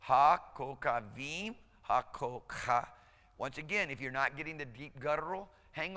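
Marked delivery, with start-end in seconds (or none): 0.1-2.9 broken down slowly. Shown as a demonstration. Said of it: "I'm gonna break it down".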